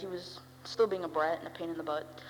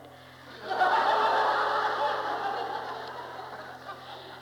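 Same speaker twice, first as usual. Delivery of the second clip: real laugh; 14 semitones higher, 4 dB louder than usual. Real, not acted.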